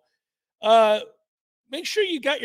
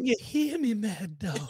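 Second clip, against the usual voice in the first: old coach impression. Imitating the deaf old coach on air.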